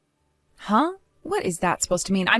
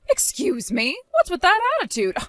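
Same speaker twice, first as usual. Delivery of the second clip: much higher, sharp and affronted; high scolding snap; 9 semitones higher than usual. Acted. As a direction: slap back at her tone. Mother-in-law snaps at daughter-in-law.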